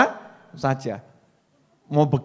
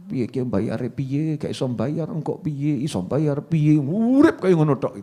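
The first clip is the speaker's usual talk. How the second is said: slow, softer mock fretting, a hint of a worried debtor; fretting worrier; 4 semitones lower, 4 dB quieter than usual. Preacher mimics a debtor worrying over repayment, slow and soft. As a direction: mumble like someone lightly fretting over unpaid debts.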